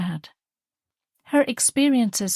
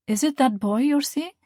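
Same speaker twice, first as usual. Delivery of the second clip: higher, louder, eager mother reminiscing; fond mother; 8 semitones higher, 3 dB louder than usual. Acted. Mother offering her daughter cake, eager and fond. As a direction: bustle about like a proud mum.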